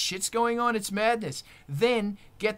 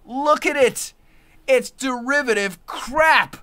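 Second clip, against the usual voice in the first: higher, louder furious rant; shouting down Netflix; 5 semitones higher, 6 dB louder than usual. Real, not acted. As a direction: blast the streaming junk in rising fury.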